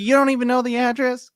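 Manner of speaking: high, mock-indignant homeowner; a hint of an offended seller; incredulous prospect. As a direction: play the annoyed woman who can't believe it. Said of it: "she's like".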